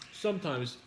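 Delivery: ordinary talk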